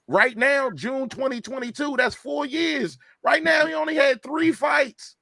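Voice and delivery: high-pitched, incredulous insistence; real exasperated emphasis, voice climbing high; worked-up arguing. Real, not acted. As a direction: pitch way up, driving the point home. Argues Spence has been inactive.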